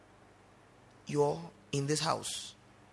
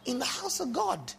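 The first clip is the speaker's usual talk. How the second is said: high, incredulous outrage; mock outrage; 9 semitones higher than usual. Real, not acted. To the congregation, high scandalised exclamation. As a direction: stress the holiness of the place.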